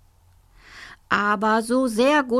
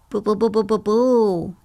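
trembling. Acted. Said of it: "zitterte Sebastian".

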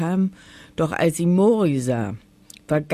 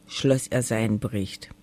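plain reading voice

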